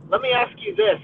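usual speaking voice

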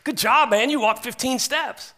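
hammed-up pat on the back; imagined cheery Jesus. Preacher imagining Jesus praising Peter, high and slow. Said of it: "you'd think Jesus would be like".

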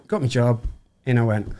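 usual speaking voice

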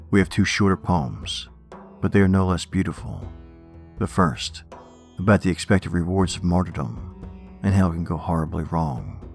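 ordinary delivery, nothing marked

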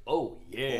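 Kool-Aid Man. Retold or imitated. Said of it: "he hops through the wall and he goes".

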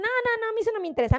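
high, rapid string of refusals; dismissive protest. Acted. Viewers rejecting technical topics, mock dismissive protest. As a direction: cut in fast and high, waving it off.

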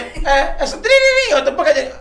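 very high, loud giggly mimicry; mock laughing. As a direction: squeal it while laughing. Mimicking triangle rhythm mockingly.

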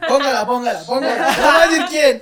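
high laughing urging; egging on through laughter; laughing coaxing. Real, not acted. Teasing friend to play voicenote.

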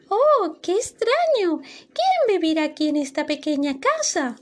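extrañeza con voz más aguda; sorprendida. Acted. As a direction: asombro curioso al entrar. Sofía entra en casita desordenada. (Sofía se pregunta quién vive en la casita, sorprendida)

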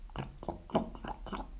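hushed, voiceless glugs; gurgling water imitation. A narrator's reading. Narrator voices water running from the vase, soft glugging.

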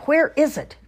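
demanding shout. Acted. Old man demands again.

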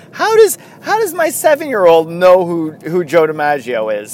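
high, loud start easing back down; amused disbelief. Retold or imitated. Father marvelling at his seven-year-old, high amused disbelief. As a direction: imitate a dad tickled and baffled. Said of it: "he was cracking up where he was like".

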